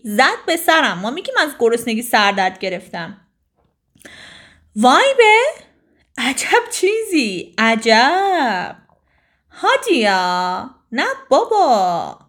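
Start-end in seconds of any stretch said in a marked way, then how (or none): 4.8-5.4 surprised cry. Shown as a demonstration. Shows surprise expression. 6.2-8.8 slow, stretched wonder; drawn-out amazement. Shown as a demonstration. Teacher shows how to say surprise, drawn out. 9.5-12.2 stretched, slow amazed exclamation; slow surprise. Shown as a demonstration. Teaching students to voice surprise, slowly.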